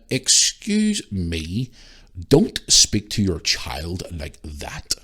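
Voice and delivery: put-on a slow, drawn-out posh "Excuse me, don't speak to your child like that"; posh busybody. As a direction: play a snooty stranger telling off a parent, very slowly. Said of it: "Real posh".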